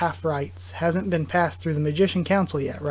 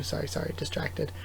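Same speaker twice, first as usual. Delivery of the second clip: softer whispered apology; whispered aside; 10 dB quieter than usual. Real, not acted. Reader apologizing for losing place, whispered. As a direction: mutter it under your breath.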